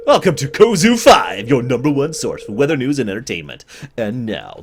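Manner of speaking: big station-ident welcome, easing off; hammed-up news announcer intro; TV weather announcer. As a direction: punch the welcome like a local TV presenter.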